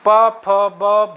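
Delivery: प, फ, ब said very slowly with the lips; lip sounds demonstrated. Shown as a demonstration. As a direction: stretch out प, फ, ब to show the lips closing. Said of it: "उ कहते समय देखिए".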